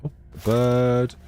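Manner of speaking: ordinary talk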